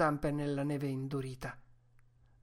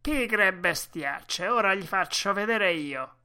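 loud, raised, threatening the dogs; angry shout; 4 semitones higher, 7 dB louder than usual. A narrator's reading. Driver about to whip his dogs, angry shout. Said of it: "gridò".

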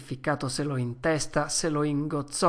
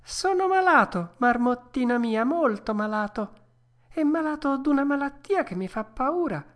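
put-on high puppet-boy voice, worried and whining; a little puppet pleading that he is ill; distressed Pinocchio; 11 semitones higher than usual. A narrator's reading. Pinocchio telling the dormouse he is sick, high and distressed.

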